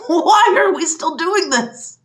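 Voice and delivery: high, loud and slow, with a bitter laugh; exasperated laughing shout. Real, not acted. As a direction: shout it, half laughing in despair. Repeats, louder, with laugh.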